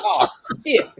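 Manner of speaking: a high, mock-dismayed "Oh, shit."; imitating Michael Caine. Mocking Michael Caine's line in a bad movie, high mock curse. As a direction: copy the actor's flat curse, hammed up high. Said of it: "Michael Caine's just saying, "Oh, shit".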